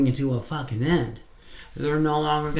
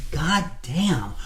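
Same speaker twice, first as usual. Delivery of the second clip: pissed-off rant; 5 dB louder than usual. Retold or imitated. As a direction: vent the frustration at the endless cycle. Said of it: "you're just pissed about it".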